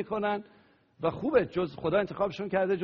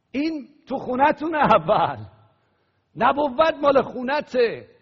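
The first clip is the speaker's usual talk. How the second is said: higher, louder fervent preaching; preaching at full force; 6 semitones higher, 7 dB louder than usual. Acted. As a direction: proclaim it to the whole church.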